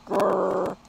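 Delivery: mock dog growl, half-hearted; a playful little growl for the kids. Shown as a demonstration. Reader showing listeners a dog's growl. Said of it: "Not very good at growling".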